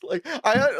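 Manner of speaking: normal talking voice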